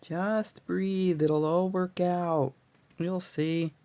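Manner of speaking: high, drawn-out singsong; almost sing it to calm yourself; singsong self-soothing. Real, not acted. Crafter calming herself over a knot, singsong.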